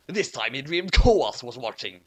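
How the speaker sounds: ordinary delivery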